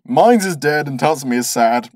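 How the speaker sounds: normal talking voice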